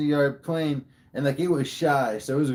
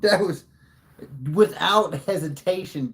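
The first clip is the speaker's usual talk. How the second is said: excited boast; 5 semitones higher, 5 dB louder than usual. Real, not acted. Rating his own story.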